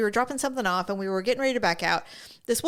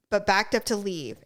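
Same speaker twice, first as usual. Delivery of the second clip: normal talking voice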